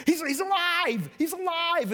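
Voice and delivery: hammed-up women bursting with news; excited cry. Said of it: "these crazy ladies come screaming".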